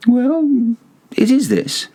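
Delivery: very high, sly reveal; sly Montresor. A narrator's reading. Montresor showing Fortunato the trowel, high and sly. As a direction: present it with a sly lilt.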